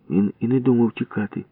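ordinary delivery, nothing marked

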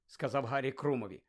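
ordinary delivery, nothing marked

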